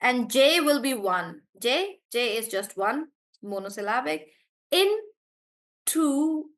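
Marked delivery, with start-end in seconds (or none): 4.7-6.5 "Intuition" very slow and higher, in-tu-i-tion; syllable by syllable. Shown as a demonstration. Teacher demonstrating the breakdown for students, syllable by syllable. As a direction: stretch each syllable of "Intuition". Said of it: "like that".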